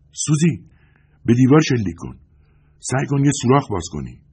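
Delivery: loud, high urgent shout. A narrator's reading. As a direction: yell the order across the room. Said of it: "داد زدم". (فریاد بلند و فوری)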